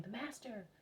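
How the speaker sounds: high, lightly voiced children's cries; storyteller's mock shout of excited children. A narrator's reading. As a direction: call it out high like excited children, without real volume. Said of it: "round them shouting".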